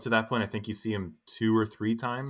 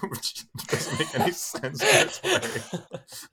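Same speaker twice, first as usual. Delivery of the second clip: laughing; 11 semitones higher, 3 dB louder, slower than usual. Real, not acted. Laughing at a favorite line.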